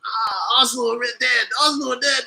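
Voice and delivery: mock the rival fans' gloating. Streamer mimics chat trolls, gloating. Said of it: "just to look at the Arsenal and type in".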